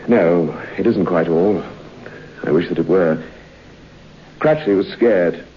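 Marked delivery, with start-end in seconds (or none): none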